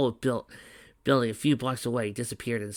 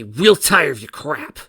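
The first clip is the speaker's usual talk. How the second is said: loud, irritated taunt; fed up; 4 semitones higher, 12 dB louder, slower than usual. Acted. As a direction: spit it out, done with him. Fed-up fighter taunts enemy.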